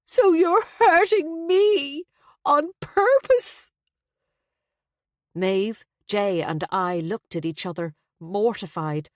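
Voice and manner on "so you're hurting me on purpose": very high, slow, loud wail; shrill, self-pitying accusation. A narrator's reading. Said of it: "became shrill and high-pitched".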